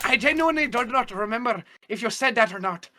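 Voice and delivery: high-pitched put-on voice; Maura Higgins. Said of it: "And Maura's".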